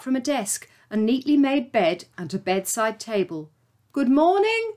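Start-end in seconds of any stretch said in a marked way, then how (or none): 3.9-4.7 high call into the empty room. Acted. Jake calling into the empty chambers, raised call. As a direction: call out, hoping someone answers. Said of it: "Jake called out".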